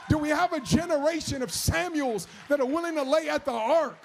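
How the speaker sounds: loud, high, driving sermon; full-force preaching. Acted. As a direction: preach it out to the crowd.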